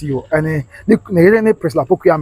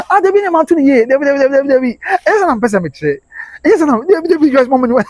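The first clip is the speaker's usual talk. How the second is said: excited high shouting; 9 semitones higher than usual. Real, not acted. Excited reply to comments.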